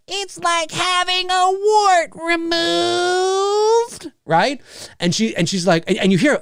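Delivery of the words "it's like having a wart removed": high, very slow eerie drawl; creepy Billy voice. Retold or imitated. Retelling the killer's phone line, high and slow. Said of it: "when Billy's like".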